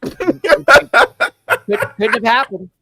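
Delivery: loud, high laughing denial. Real, not acted. Host laughs off nearly saying the wrong name. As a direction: laugh it off loudly. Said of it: "laughing denial".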